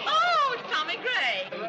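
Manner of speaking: high, drawn-out welcome; delighted greeting. Acted. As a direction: sing out a slow, high hello to a guest. Hostess greets an arriving guest.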